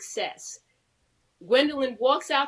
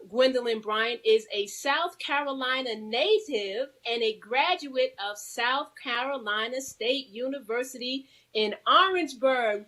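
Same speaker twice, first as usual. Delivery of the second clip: ordinary delivery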